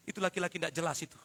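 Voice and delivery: tossed off quickly; fast throwaway aside. Acted. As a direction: rattle it off as an aside. (cepat, seperti sambil lalu)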